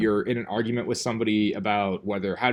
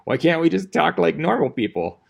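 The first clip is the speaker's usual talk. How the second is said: louder, higher, fed-up exasperation; exasperated aside; 6 semitones higher, 8 dB louder than usual. Retold or imitated. Teacher facing a hostile parent, exasperated and louder. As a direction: throw up your hands, louder. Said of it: "Sometimes I'm just like".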